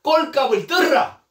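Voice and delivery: high, loud acted put-down; scornful insult. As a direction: spit out contempt at a rival.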